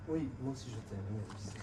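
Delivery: hushed. Acted. Secret lover hanging up quietly.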